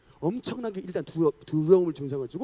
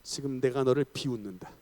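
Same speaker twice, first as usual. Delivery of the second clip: deadpan scorn; 6 semitones lower, 4 dB quieter than usual. Retold or imitated. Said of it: "뭐라 그랬겠습니까?".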